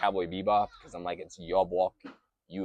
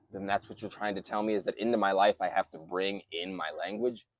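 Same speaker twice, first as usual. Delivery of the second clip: ordinary talk, nothing marked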